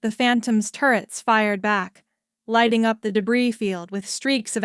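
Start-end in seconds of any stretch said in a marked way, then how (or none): none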